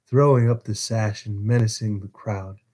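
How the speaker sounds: ordinary delivery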